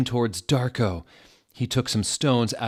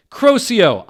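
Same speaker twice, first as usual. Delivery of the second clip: much higher, much louder curse; hammed-up wizard battle cry; shouted spell; 13 semitones higher, 14 dB louder than usual. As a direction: blast the spell out. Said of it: "I shouted".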